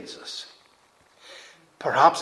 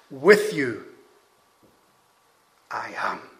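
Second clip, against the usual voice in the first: weighty pause between "With you" and "I am"; emphatic reversed order; 5 dB louder, slower than usual. Shown as a demonstration. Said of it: "Emphasizing the presence of the Lord".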